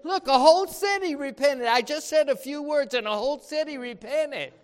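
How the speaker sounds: loud, high, fast triumphant crowing; overjoyed boast about a whole city; rejoicing evangelist. Pretend. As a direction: brag like a preacher thrilled with his results. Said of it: "he would be rejoicing".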